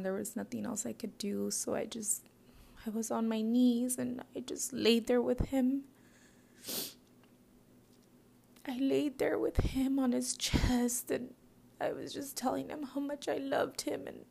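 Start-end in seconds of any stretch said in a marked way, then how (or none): 4.0-14.1 1 grief-stricken. Real, not acted. Crying recall beside dead husband.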